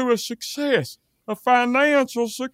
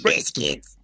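very deep ghost voice; low spectral croak; ghostly interjection. Acted. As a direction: drop it in from the depths. Ghost's spooky one-word interjection.

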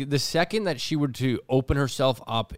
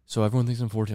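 put-on lowered-voice girl; 2 semitones lower, faster than usual. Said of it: "she got her voice lowered".

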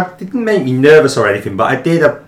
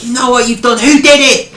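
mock Birmingham boss, menacing repeat; Birmingham manager; 13 semitones higher than usual. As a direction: manager in high Birmingham accent. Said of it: "Like, and".

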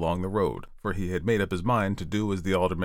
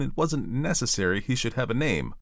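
ordinary delivery, nothing marked

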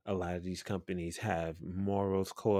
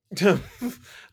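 burst of laughter; 13 semitones higher, 11 dB louder than usual. Real, not acted. Laughing at fear of being fired.

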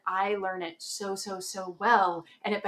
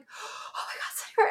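breathless whisper of awe; starstruck whisper. Retold or imitated. Meeting her idol at Hacker Summer Camp, awed whisper. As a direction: whisper her name like seeing a celebrity.

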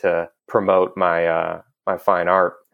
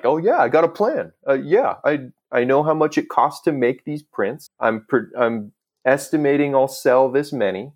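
higher-pitched mock of his overconfident younger self; naive confidence; 6 semitones higher than usual. Photographer mocking his teenage business plan, naively confident. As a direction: put on a cocky kid's higher voice.

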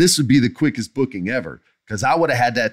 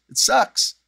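higher pitched; 12 semitones higher than usual. Real, not acted. Repeats it sucks, higher.

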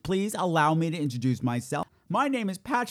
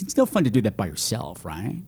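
normal talking voice

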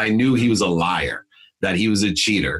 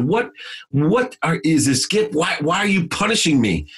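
enraged; 9 semitones higher than usual. Retold or imitated. Son confronting father on phone, high and enraged. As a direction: accuse him, voice climbing in anger. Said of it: "I immediately got enraged".

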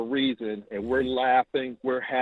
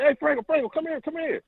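higher calls of Franco, Franco, then come here; calling out; 13 semitones higher than usual. Retold or imitated. Waving Franco over at the blackjack table, calling out. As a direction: hail him across the room.

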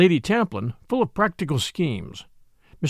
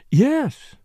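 a sudden high, louder yes; 9 semitones higher, 5 dB louder than usual. Acted. Lady Tamplin waking from a reverie, sudden bright yes. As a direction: snap out of a daydream. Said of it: "coming suddenly out of her reverie".

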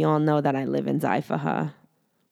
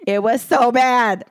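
loud, high groaning emphasis; exasperated; 8 semitones higher, 7 dB louder, slower than usual. Real, not acted. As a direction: wail how bad it was. Exasperated about the dancing.

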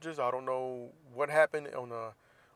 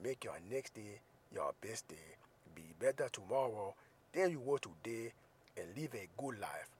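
lower, softer closing motto voice; sign-off voice; 4 semitones lower, 9 dB quieter than usual. Acted. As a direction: deliver the farewell motto low and soft, like a late-night host.

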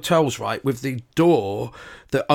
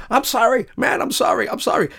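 panicked apology; 10 semitones higher than usual. Retold or imitated.

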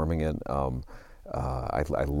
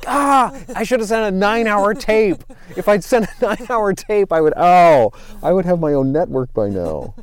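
mock outrage; 10 dB louder than usual. Joking about a nine-hour tape.

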